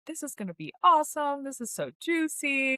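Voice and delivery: replaying the rush of reading a great script; excited gushing. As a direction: gush up high with retold thrill. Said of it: "I was like".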